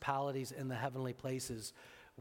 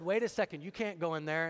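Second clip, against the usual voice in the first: raised, higher urgent call; alarmed protest; 4 semitones higher, 7 dB louder, faster than usual. Retold or imitated. Husband calls out to wife heading into the store, alarmed. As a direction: stop her before she walks in. Stopping wife entering Marshall's.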